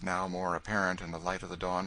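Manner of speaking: ordinary delivery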